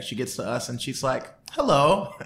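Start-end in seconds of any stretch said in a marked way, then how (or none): 1.5-2.1 bright hello. Retold or imitated. Staffer greets the teen fans.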